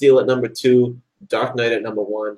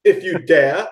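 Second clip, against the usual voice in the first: high mocking villain challenge; taunting villain; 6 semitones higher than usual. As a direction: taunt the hero, hammed up. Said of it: "He goes".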